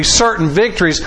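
ordinary delivery

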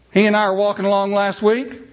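ordinary talk, nothing marked

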